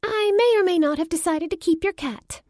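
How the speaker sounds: high, loud and cheeky; defiant. Acted. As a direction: toss it out with playful defiance. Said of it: "Riley looked at me defiantly".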